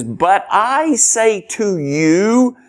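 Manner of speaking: "I" stretched and pitched high; emphatic. Shown as a demonstration. Preacher quoting Jesus from the Sermon on the Mount, emphatic. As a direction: lean slowly and firmly on the "I". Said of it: "here is emphatic".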